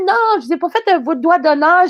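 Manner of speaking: lying nervously to the angry driver; nervous denial. Retold or imitated. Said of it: "Je fais".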